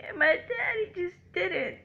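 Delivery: real crying over an absent father. Real, not acted. Crying while recounting absent father.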